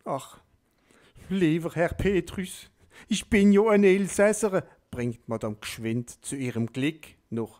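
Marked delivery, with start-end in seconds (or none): 0.1-4.7 hoch und laut flehend; flehend erleichtert. A narrator's reading. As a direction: fleh den Petrus an. Madame rettet sich flehend. (Madame fleht Petrus hoch und laut an)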